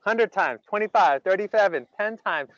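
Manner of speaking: ordinary delivery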